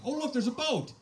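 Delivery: put-on high, loud excited little girl; excited child. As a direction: squeal it like a five-year-old spotting a boat. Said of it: "she's going".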